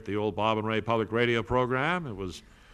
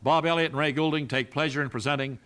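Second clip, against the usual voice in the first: louder, higher show announcer voice; announcer intro; 3 semitones higher, 4 dB louder than usual. Acted. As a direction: open the show like a grand radio host.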